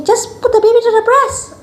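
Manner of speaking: high-pitched mock nurse instruction; put-on high voice, making it sound simple; imitated nurse. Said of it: "Then the nurse say".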